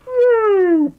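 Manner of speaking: loud, high-pitched woo; high whoop. Shown as a demonstration. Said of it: "I have, like".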